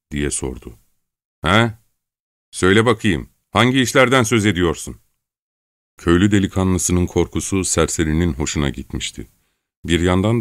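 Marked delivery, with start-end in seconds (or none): 1.4-5.0 stern interrogation, pitched up; hard voice. Acted. Çelkaş grilling the villager on his words, hard voice. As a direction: press sharply for an answer. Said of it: "Çelkaş sert bir sesle".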